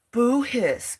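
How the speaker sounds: high, loud playful booing; mock boo. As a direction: boo at the algorithm like a pantomime crowd.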